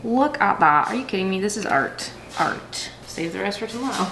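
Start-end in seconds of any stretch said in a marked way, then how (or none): 2.4-2.9 whispered echo. Real, not acted. Vlogger admiring her cold brew to camera, hushed whisper. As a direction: whisper the word Art softly, unvoiced.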